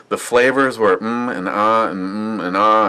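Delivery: ordinary talk, nothing marked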